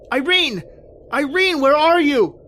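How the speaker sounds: high calling out, fading off; shouting for wife. Acted. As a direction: call desperately for his wife into the dark forest. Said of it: "crying, shouting".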